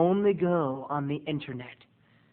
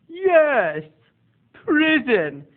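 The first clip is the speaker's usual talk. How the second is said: high, loud, stretched answer; emphatic pronouncement in the odd aunt voice; hammed-up shrieky impression; imitated eccentric aunt; 8 semitones higher, 6 dB louder, much slower than usual. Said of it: "she talks like this".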